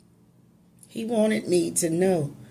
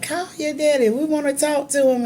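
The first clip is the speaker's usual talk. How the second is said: retold cheering crowd, much higher and louder; relatives egging her on; 11 semitones higher, 4 dB louder than usual. Relatives at the party urging her to call, excited. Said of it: "they was like".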